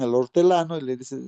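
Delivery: ordinary delivery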